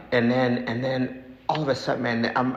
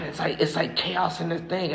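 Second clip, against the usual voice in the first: ordinary talk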